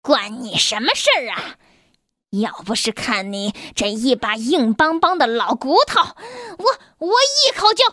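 vicious. Acted. Wolf threatening mother sheep, vicious and rising. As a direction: threaten her like a hungry wolf. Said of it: "恶狠狠地说".

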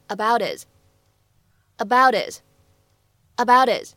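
"about it" linked with a flap T; flapped t linking. Shown as a demonstration. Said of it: "我们可以读成".

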